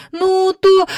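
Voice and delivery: put-on high, hesitant child's voice; little girl stuck for words; faltering girl. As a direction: start an offer and falter, pitched high. Jane falters while pleading.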